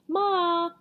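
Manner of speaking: high, loud snivel; sniveling boy. A narrator's reading. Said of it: "the younger boy sniveled".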